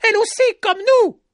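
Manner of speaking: very high, loud howl. A narrator's reading. Another villager howling to kill her, very loud. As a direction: howl for blood. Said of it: "hurla un autre".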